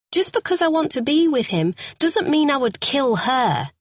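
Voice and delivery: a frightened suspect begging to be believed; Carol, distressed woman. A narrator's reading. Suspect pleads her story to the sergeant, high and pleading.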